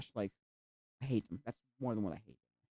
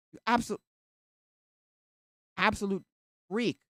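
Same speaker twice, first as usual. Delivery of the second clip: annoyed retort; 6 semitones higher, 6 dB louder, slower than usual. Retold or imitated. Yelling at ASMR streamers.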